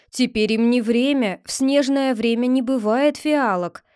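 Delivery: usual speaking voice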